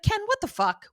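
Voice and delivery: very high, incredulous squeak of protest; imitated outrage without extra volume; mock indignant disbelief. Repeats Buffy confronting Ken.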